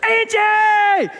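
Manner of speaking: high, drawn-out holler for his wife; retold shout. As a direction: holler her name across the house. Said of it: "And I shouted".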